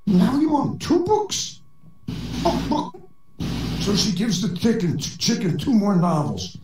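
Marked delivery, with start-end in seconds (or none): 0.1-1.6 much higher, slow incredulous librarian; surprised librarian. Acted. Librarian amazed the chicken wants more, high and slow. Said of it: "Librarian says". 2.4-2.9 high whispered clucking; chicken clucking. Acted. The chicken answering the librarian, high whispered clucks. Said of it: "the chicken comes back and says".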